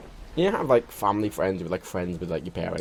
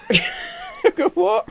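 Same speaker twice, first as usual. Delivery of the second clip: 1 laughing disbelief; much slower than usual. Real, not acted. Disbelief at paper smoking story.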